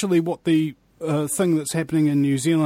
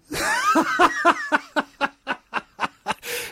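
laugh at own impression; 13 semitones higher than usual. Real, not acted. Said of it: "done with a funny impression".

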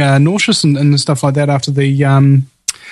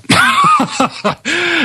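laughing. Real, not acted. Host laughs at comment.

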